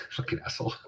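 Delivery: laughing under breath; whispered laughing curse. Real, not acted.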